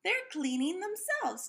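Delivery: eager discovery. Acted. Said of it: "Tim figured out".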